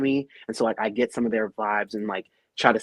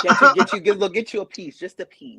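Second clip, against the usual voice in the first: laughing; 8 semitones higher, 7 dB louder than usual. Acted. Playful sassy bit.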